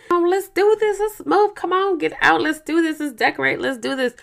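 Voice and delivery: high, loud eager cheer, fading off; mock excitement. Mocking her husband's eager excitement, loud cheerful cheer. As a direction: mimic his pumped-up hype.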